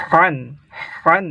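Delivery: হান with a heavy ha from the throat; throaty han. Shown as a demonstration. Teacher showing the throat sound. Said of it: "গলা থেকে".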